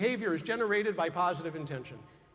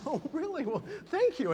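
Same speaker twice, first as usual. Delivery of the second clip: flattered reply; 9 semitones higher than usual. Retold or imitated.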